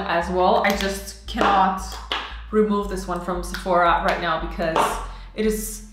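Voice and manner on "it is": whispered. Real, not acted. Trailing confession.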